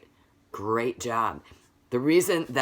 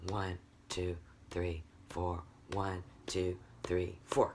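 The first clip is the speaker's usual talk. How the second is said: "One, two, three, four" spaced evenly on each beat; steady beat count; 6 semitones lower, 9 dB quieter, slower than usual. Shown as a demonstration. Teacher leading the class in counting beats, steady low count. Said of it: "Everybody just do that".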